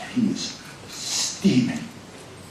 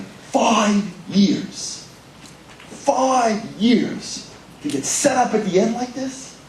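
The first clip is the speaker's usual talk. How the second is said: bitter disbelief; 12 semitones higher, much slower than usual. Retold or imitated. Bitter disbelief lying in bed.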